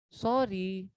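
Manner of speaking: Sorry said soft and low; a soft humble sorry; gentle sorry. Shown as a demonstration. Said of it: "tone का फर्क आया". (Sorry धीमे, नरम लहजे में)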